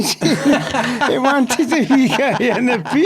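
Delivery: words through real laughter; cracking up at his own story; laughing. Real, not acted. Teller cracking up at punchline.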